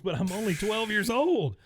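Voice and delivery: high, loud imitation of a dismayed kid; mock boyish protest. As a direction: wail the objection like a twelve-year-old. Acting the boy's shocked protest.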